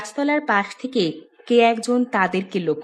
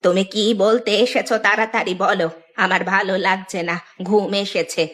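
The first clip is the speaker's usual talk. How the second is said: aged granny voice, tired and put out; impatient old woman; 2 semitones lower than usual. Acted. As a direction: hurry the visitor along as a sleepy old woman. (বৃদ্ধার বিরক্ত, ক্লান্ত গলা)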